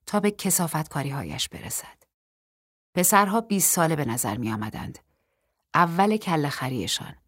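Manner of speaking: ordinary delivery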